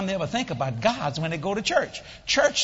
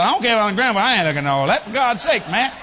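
loud grumpy refusal; 4 semitones higher, 6 dB louder, faster than usual. Retold or imitated. Grandfather refusing.

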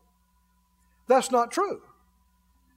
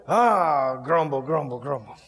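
mock grumbling; 7 semitones lower than usual. Grumbling at the alarm clock.

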